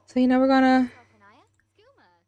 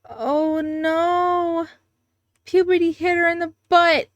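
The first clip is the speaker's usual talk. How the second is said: high, drawn-out playful "oh no" reaction; exaggerated dismay at a game mishap; 7 semitones higher, slower than usual. Real, not acted. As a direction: wail it in mock alarm, pitched up. Reacts to Sim's puberty mishap.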